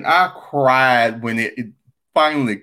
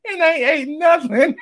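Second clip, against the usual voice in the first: laughing. Real, not acted. Still in laughing fit.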